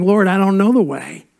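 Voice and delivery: pleading prayer to the Lord; mild pleading cry; prayerful cry. Pretend. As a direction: plead as if crying out to God. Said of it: "crying out and saying".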